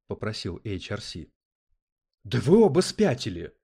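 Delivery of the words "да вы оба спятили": loud, high outraged outburst; indignant. A narrator's reading. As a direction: protest loudly, appalled. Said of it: "возмутился Терри".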